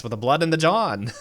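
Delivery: laughing, high transatlantic lilt; hammed-up old-Hollywood accent. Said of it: "practicing your transatlantic accent".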